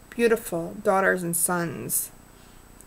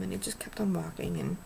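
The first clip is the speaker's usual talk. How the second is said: hushed whisper, almost no voice; soft whispered aside, touched by the moment; 7 dB quieter, faster than usual. Real, not acted. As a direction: let it fall to a whisper, moved. Tender moment with the veteran.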